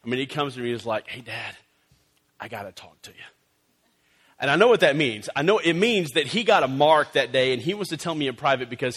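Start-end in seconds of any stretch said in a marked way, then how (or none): 1.1-3.2 put-on hushed little boy asking to talk; soft and hesitant, a kid with bad news; a quiet, worried son; quiet confiding.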